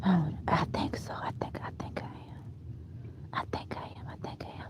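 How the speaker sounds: barely voiced whisper, repeated in doubt; whispering to herself. Real, not acted. Answering her own question, unsure, in a whisper. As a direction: mutter it privately, almost no sound.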